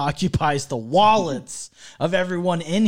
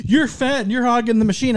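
put-on higher bratty kid voice; kid's taunt; 10 semitones higher, 4 dB louder than usual. A kid at the claw machine taunting an adult. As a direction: play a rude little kid taunting. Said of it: "He comes up, he's like".

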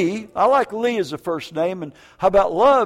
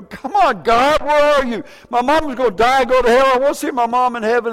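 higher, louder, fast pleading with God; desperate prayer; 6 semitones higher, 5 dB louder than usual. Retold or imitated. Son begging God to save his mom, loud and fast. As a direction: cry out to God in a hurry.

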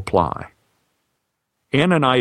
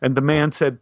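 usual speaking voice

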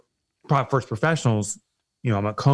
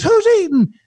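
much higher, gushing Southern customer-service voice; excited Southern rep; 6 dB louder than usual. Retold or imitated. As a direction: play the overjoyed phone guy from the South. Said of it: "because of the guy's accent".

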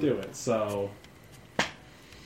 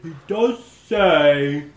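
slow half-orc; 4 semitones higher, 5 dB louder, much slower than usual. Acted. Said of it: "one of the half-orcs like".

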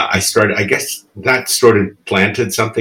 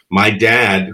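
ordinary delivery

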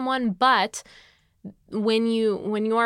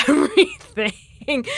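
laughing; 6 semitones higher, 9 dB louder, much slower than usual. Real, not acted. Laughing about crying easily.

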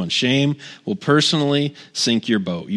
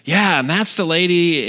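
lightly imitated eager gossip, pitched higher; launching into gossip; 6 semitones higher than usual. New neighbor tells pastor about the lady, launching in. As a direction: jump right in like a gossiping neighbor. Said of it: "she just launched in".

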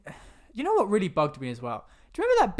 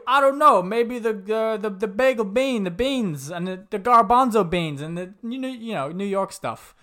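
a hint of New York accent, louder and higher; mock New York banter; 4 semitones higher, 6 dB louder than usual. Podcaster mimics New York guys bantering, mild New York accent. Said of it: "New York guys having some New York banter".

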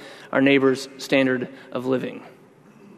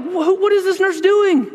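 much higher, alarmed retelling; freaking out; 4 dB louder than usual. Retold or imitated. Husband recalls panicking at the nurse's words, pitched high. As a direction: voice jumps up in mock panic. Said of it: "I'm just freaking out".

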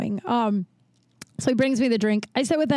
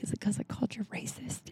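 whispery, much softer question; hushed needling; 12 dB quieter, faster than usual. Retold or imitated. Woman asking the angry man why she must leave, hushed needling. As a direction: drop to a near-whisper to needle. Needling the cop who ejects her.

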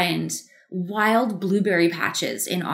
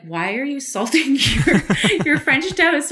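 laughing disbelief; 5 semitones higher, 3 dB louder than usual. Retold or imitated. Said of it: "my girlfriends being like".

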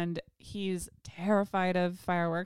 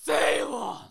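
loud, high scolding yell of the name; appalling stern voice; 6 semitones higher, 5 dB louder than usual. Retold or imitated. Imitating partner catching the dog peeing mid-embrace, stern and appalled. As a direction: imitate a horrified dog owner calling out sternly. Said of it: "his most appalling and stern voice".

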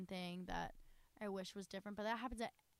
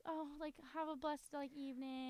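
high, soft and syrupy; lightly voiced sweet Southern lady; sugary Southern blessing; 7 semitones higher, 3 dB quieter than usual. Shown as a demonstration. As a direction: coo it like a sweet church lady.

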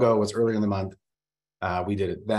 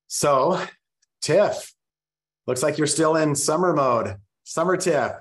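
calling out; 7 semitones higher, 6 dB louder than usual. Real, not acted. Calling to a muted guest.

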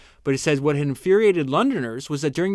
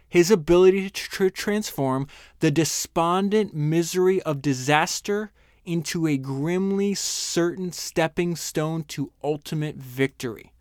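slow, raised, savoring every word; slow emphatic reading; 3 semitones higher, slower than usual. Shown as a demonstration. Host reads his favourite Churchill line aloud, slow and emphatic. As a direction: lean into each phrase slowly, building awe. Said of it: "Let me read that again".

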